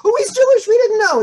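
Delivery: loud, very high-pitched mock astonishment; mock amazed outburst. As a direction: squeal it as a comic revelation. Students' surprised discovery, acted out.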